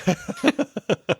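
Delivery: high-pitched burst of laughter; real high giggle. Real, not acted. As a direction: crack up for real. Host cracks up at the bit.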